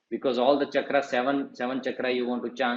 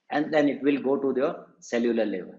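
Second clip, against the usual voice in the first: ordinary delivery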